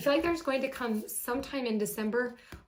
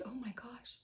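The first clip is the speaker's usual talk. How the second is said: awed whisper; 14 dB quieter than usual. Real, not acted. Overwhelmed tarot reader.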